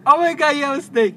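high, loud, over-the-top gushing; mock gushing. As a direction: ham up a fake thrilled customer. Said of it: "she wants me to go to the window and be like".

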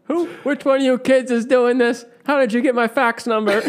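put-on high, shouting at prankster kids; high mock shouting. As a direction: yell at imagined kids.

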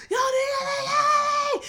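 high hammed-up shout; mock R. Kelly yelling. Vlogger mimicking R. Kelly's interview meltdown, mock screaming. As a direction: screech it like a tantrum. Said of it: "hooting and hollering and screaming".

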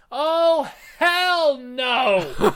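high, loud, laughing "hell no"; mock outraged refusal. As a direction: blurt out a hammed-up refusal. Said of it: "And Seth goes".

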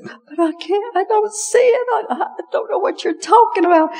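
imitating a frightened pregnant woman on the phone; nervous young wife. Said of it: "she was very nervous".

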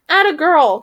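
high, loud cheer; cheering cry. A narrator's reading. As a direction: cry out in encouragement. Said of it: "cried the first raven".

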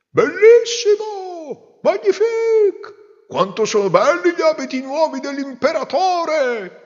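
ecstatic crowd. Acted. People in the street cheering the emperor, ecstatic. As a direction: swoon with delight. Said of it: "non faceva che dire".

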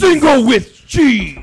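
slow, loud drawn-out yell; shouting. Pretend. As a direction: bellow it like a hype man. Shouting Wendy's menu items.

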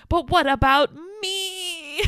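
high, slow mock wail; whiny self-pity. Imitating the boyfriend making it about himself, whiny. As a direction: draw out ME in a fake sob.

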